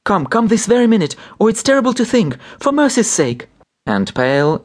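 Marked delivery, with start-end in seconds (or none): 0.0-3.5 very high, urgent begging; desperate pleading. A narrator's reading. Wife begging the doctor for her sick husband, desperate pleading. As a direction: plead as if every second counts.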